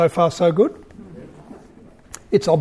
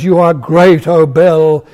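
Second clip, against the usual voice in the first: raised, louder call to the god; loud shout; 7 dB louder, slower than usual. A narrator's reading. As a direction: proclaim it loudly to the idol. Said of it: "he shouted in a loud voice".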